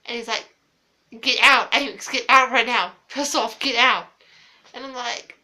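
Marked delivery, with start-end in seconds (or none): 1.2-4.1 loud angry shout, barking orders; furious yelling. Retold or imitated. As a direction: throw her out at full volume. Brother caught, yelling her out.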